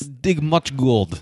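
very slow, heavy put-on Eastern European accent; halting broken-English impression, badly done; bad mock accent. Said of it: "I can't do this voice at all".

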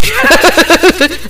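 high bright burst of laughter, falling away; a real laugh that spills out high and drops. Real, not acted. Laughing after cursing brother.

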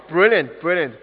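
ordinary delivery

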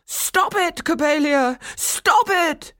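high, loud desperate shout; desperate cry. A narrator's reading. Old man shouting at his raging doll, high and loud. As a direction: plead with all your strength. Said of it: "Coppelius cried".

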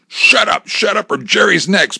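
loud, high-pitched yell; yelled threat. A narrator's reading. Gunman to screaming hostage, yelled threat. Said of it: "Bob yelled".

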